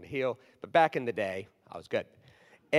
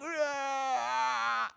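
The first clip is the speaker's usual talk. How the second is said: mock punch grunt; 9 semitones higher, 3 dB quieter than usual. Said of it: "It was like".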